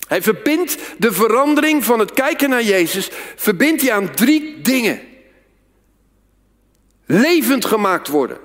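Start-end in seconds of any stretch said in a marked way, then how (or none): none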